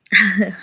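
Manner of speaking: short embarrassed laugh; sheepish laugh. Acted. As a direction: laugh off the clumsiness. Laughs at the mess.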